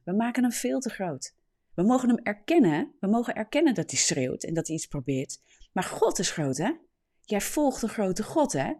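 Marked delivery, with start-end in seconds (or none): none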